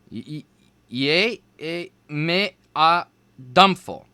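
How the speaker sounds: halting, stumbling syllables; unsure stammer. A narrator's reading. Nervous student stumbling over the spell, unsure stammer. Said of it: "He didn't sound too sure".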